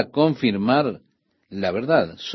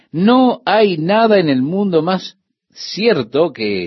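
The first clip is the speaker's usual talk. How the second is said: slow, raised, forceful stress on "no hay nada"; emphatic certainty; 6 semitones higher, 4 dB louder, slower than usual. Real, not acted. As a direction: drive home "no hay nada" slowly, high and firm. Preacher stressing certainty of God's oath.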